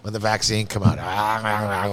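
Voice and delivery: ordinary talk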